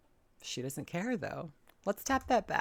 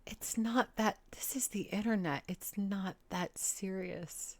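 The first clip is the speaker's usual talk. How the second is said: whispering; 4 semitones higher, slower than usual. Real, not acted. Calming down the ranter.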